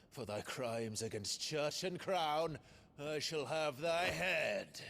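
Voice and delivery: high, grand declaiming knight, dropping to a whisper at the saint's name; a young man hamming up a stage knight's challenge; hammy stage knight. Acted. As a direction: play the heroic knight to the back row. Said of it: "fond of that scene from The Saint".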